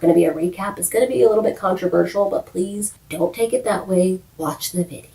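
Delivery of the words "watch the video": whisper. Real, not acted. Playful aside to viewers.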